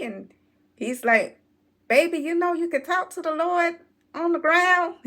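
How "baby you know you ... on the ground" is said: high, louder gentle pleading; dad talking his daughter down from the tree; coaxing dad. Retold or imitated. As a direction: coax the kid out of the tree.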